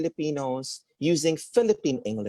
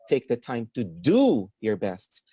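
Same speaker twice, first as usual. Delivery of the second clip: slow, with "do" raised and drawn out; stress on DO; 2 semitones lower, slower than usual. Shown as a demonstration. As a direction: punch the word "do". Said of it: "kung saan nyo po ilalagay yung stress".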